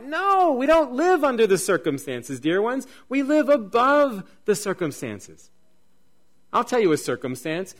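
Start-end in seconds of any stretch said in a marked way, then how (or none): 0.0-5.5 loud, high preaching protest; forceful, raised-voice contradiction; emphatic rebuttal. Real, not acted. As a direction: cry out the correction to the congregation.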